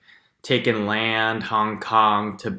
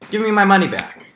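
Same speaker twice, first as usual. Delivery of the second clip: louder, firm demand for the money; mock demand; 8 semitones higher, 6 dB louder than usual. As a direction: insist on getting the refund back. Demanding refund.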